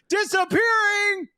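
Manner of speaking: high yelled word; shouting capitalized words. Acted. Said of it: "When it's all caps, I have to yell".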